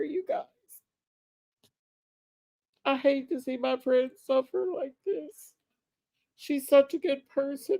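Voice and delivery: holding back tears for a sick friend; emotional. Real, not acted. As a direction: voice lifted and shaky with grief for a friend. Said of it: "I feel really emotional right now".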